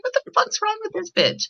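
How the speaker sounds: high, squeaky, exasperated; mock outrage. Viewer annoyed at the blind character, high mock outrage. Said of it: "I was like".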